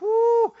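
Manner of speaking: high, loud, drawn-out "whoo"; delighted whoop. Retold or imitated. Guest lies down on the pastor's big bed, acted delighted whoop. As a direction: whoop as if sinking into luxury.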